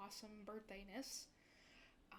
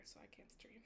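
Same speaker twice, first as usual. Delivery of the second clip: whispered aside; 16 dB quieter than usual. Real, not acted. Aside about not streaming.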